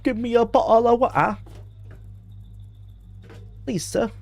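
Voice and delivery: high-pitched mock beggar voice; pleading water beggar. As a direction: beg in a put-on high voice, softening at the end. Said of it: "water beggars who are like".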